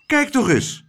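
urgent high-pitched call; excited high cry. A narrator's reading. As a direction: call out in alarm to look. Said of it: "riep Klaterhoen".

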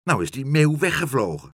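high, loud exasperation; angry cry. A narrator's reading. Woman berating the cat for losing the bird, angry cry. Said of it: "riep ze".

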